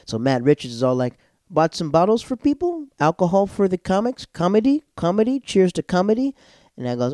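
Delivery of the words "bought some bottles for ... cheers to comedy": high, loud rising phrases; imitated excited toast. Comic host toasts comedians with bottles, exuberant. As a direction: offer drinks around, each phrase lifting upward.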